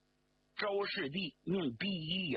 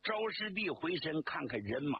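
ordinary delivery, nothing marked

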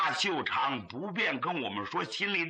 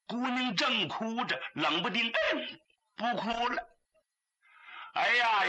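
usual speaking voice

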